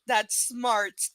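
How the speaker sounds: normal talking voice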